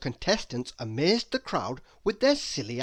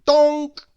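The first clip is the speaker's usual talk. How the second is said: cartoon bonk; 12 semitones higher, 14 dB louder than usual. A narrator's reading. Ball hits Mister Nonsense's nose.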